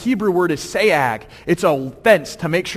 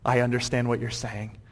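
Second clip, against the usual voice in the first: low, soft, dawning understanding; subdued acted acceptance; quiet realization; 5 semitones lower, 8 dB quieter than usual. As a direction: say it softly as it clicks. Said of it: "he realizes".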